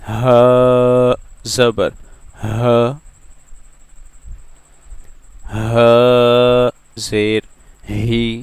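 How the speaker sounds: ह drawn out slowly and loud from the bottom of the throat; teacher spelling ह for students; slow throat letter drill. Shown as a demonstration. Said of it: "निचले part से हम इसको pronounce करेंगे".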